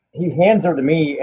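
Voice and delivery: ordinary delivery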